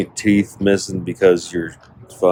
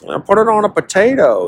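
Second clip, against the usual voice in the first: excited yelp; 11 semitones higher than usual. Real, not acted. Riffing on sour cream eater.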